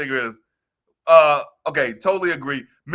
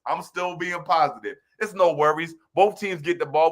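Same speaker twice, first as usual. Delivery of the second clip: ordinary delivery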